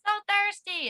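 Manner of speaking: put-on high, loud child begging mom; whiny child. Kid begging mom for water, whiny and loud. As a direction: plead like a parched little kid. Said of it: "we'd be like".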